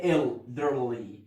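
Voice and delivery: put-on elderly stretched very slowly, syllable by syllable; split into syllables. Shown as a demonstration. Teacher slowly splitting elderly into parts for students. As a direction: break elderly apart slowly. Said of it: "هقسمها ازاي".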